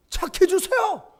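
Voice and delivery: high, strained yell for the car key; mock angry shout. Husband yelling at his wife over the car key, high angry shout. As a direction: shout it at your wife, losing it.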